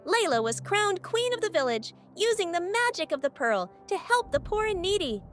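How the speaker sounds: ordinary delivery, nothing marked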